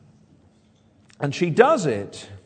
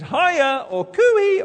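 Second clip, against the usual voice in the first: high, loud hello at the door; visitor calling out; 13 semitones higher, 6 dB louder, much slower than usual. Acted. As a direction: call out a cheery arrival. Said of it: "she says".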